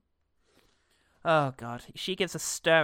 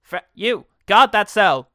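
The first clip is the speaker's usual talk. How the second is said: loud, higher command to a peon; barked game order; 5 semitones higher, 12 dB louder, slower than usual. Pretend. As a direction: bark the order at a worker unit. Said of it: "I'm one of the peons from Warcraft".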